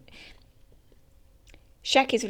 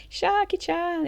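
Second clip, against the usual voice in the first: excited fan call; 9 semitones higher, slower than usual. Acted. Fan calls out to Shaki.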